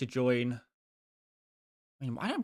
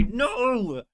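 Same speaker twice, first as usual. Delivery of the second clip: exasperated scolding; 8 semitones higher, 4 dB louder than usual. Retold or imitated. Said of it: "And I just wanna say".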